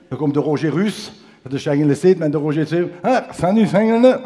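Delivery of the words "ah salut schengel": cheery greeting. Retold or imitated. Roger greeting the customer.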